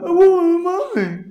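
very high-pitched mock blubbering; crying little boy. Boy crying at father's house for mommy, imitated high wail. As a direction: imitate a small child wailing for a parent. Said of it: "the little boy starts crying".

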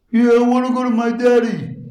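high-pitched mock child's wail; crying little boy. Boy begging mother for daddy at bedtime, imitated high wail. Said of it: "he starts crying".

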